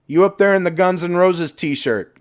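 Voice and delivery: high, loud hail from below; man below calling up to them. A narrator's reading. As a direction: call up across the hangar. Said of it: "came a familiar voice from below".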